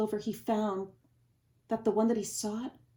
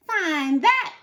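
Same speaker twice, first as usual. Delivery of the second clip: loud, higher taunting sneer; 8 semitones higher, 9 dB louder than usual. Acted. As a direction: taunt him with contempt. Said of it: "sneered the bird".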